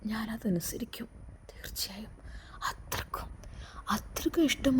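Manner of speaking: breaking down into a whisper. A narrator's reading. Jaseer vowing his love to Noorjahan, whispered and breaking. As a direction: barely breathe the promise out. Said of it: "ജസീറിൻ്റെ ശബ്ദം ഇടറുന്നുണ്ടായിരുന്നു".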